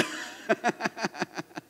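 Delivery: embarrassed laugh. Real, not acted.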